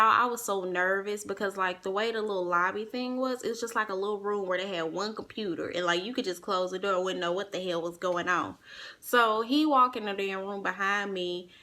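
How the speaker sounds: ordinary delivery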